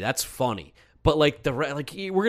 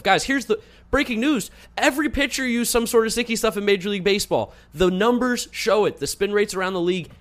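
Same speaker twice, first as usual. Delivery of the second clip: raised, emphatic rant; worked-up rant; 8 semitones higher than usual. Real, not acted. Ranting announcement of breaking news.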